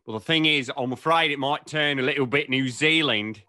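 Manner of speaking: Australian accent that might drift towards New Zealand; attempted Australian accent. Shown as a demonstration. Interviewer trying out an accent to be graded, attempted Australian accent. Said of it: "I'm tempted to try one and have you grade me".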